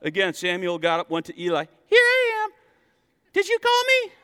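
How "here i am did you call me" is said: put-on high little boy voice; a sleepy boy running to Eli. Voicing young Samuel.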